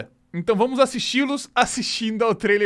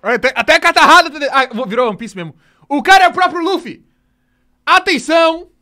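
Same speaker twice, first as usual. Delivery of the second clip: loud, higher, fast amazed outburst; excited shout; 6 semitones higher, 10 dB louder than usual. Real, not acted. Watching the actor cry, he shouts his amazement to viewers. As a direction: burst out with delight at the snotty crying.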